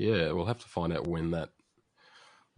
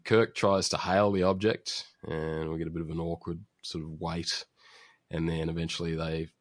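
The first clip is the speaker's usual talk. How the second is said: ordinary talk, nothing marked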